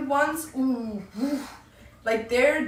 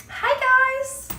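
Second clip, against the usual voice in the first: put-on high, loud girly greeting; squeaky fake gamer-girl hello; cutesy girl; 13 semitones higher, 4 dB louder than usual. Imitates girl in voice chat.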